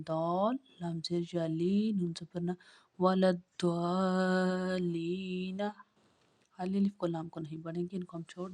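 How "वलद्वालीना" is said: slow, stretched out word; slow blended reading. Shown as a demonstration. Teacher joining the letters for a pupil, slowly. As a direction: sound it out slowly. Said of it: "teacher blends spelled letters".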